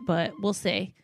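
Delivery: ordinary talk, nothing marked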